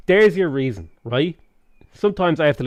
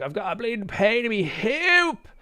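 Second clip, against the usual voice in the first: much higher, hammed-up north Dublin accent; pitched-up mock Dublin lad, leaning hard on hoop; north side Dublin lad; 10 semitones higher than usual. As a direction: put all of Irish history into hoop. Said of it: "heard a north side lad just be like".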